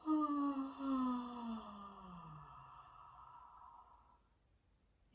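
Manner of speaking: soft, unhurried meditation guide voice; guided meditation. Acted. Yoga teacher guides anxious viewers through breathing, in a soft meditation voice. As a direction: ease the listener into calm breathing.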